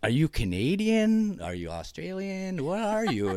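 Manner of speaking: high, loud mock questioning; hammed-up puzzled visitor; curious outsiders. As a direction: quiz him like a curious tourist. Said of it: "like, you're".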